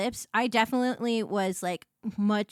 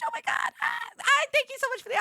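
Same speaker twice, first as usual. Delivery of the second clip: excited shout. Real, not acted. Overwhelmed on hearing thanks.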